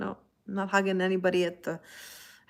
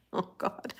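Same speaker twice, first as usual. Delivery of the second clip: normal talking voice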